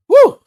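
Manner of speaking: loud, high "woo!"; excited whoop. Real, not acted. Host releasing excitement before the recap, whooping. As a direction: let out a sudden high whoop.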